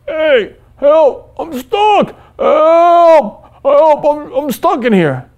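high, crying calls for help; desperate cry for help. Pretend. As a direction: plead frantically to be freed. Trapped patient calling for help.

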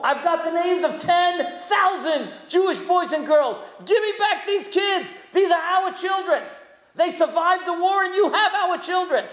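a rabbi confronting the Pope, pleading at full voice; impassioned plea. Retold or imitated. Rabbi retells demanding kids from Pope.

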